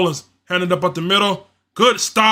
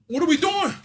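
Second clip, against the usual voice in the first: higher, disbelieving cry; exasperated question; 10 semitones higher than usual. Real, not acted. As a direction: throw it up in disbelief. Exasperated fan at bad offense.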